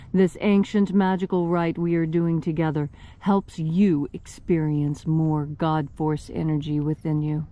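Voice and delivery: ordinary talk, nothing marked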